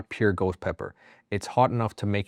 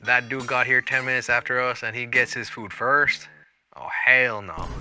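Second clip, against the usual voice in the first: put-on southern accent; 3 semitones higher than usual. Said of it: "southern accent".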